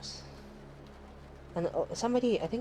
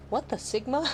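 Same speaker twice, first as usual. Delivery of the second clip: put-on higher, louder kid's blurt; imitating the kid; 7 semitones higher, 4 dB louder than usual. Streamer imitating her child blurting slang from the couch. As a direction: chirp it out like a little kid. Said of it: "And you hear".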